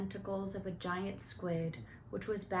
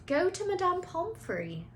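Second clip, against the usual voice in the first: much higher, eager schoolgirl; Hermione voice; 10 semitones higher, 4 dB louder than usual. Acted. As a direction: suggest it brightly. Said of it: "Hermione suggested".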